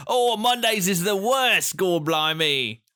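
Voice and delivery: high, hammed-up Cockney cat voice; mock Cockney. A cat moaning about Mondays in mock Cockney. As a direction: ham up a Cockney Garfield complaining.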